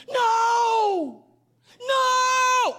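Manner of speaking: loud high cry of no; kid yelling no. Retold or imitated. Kid losing at Uno, yelling no. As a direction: shout it like a kid losing at cards. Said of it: "I hear some go".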